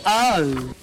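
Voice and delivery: usual speaking voice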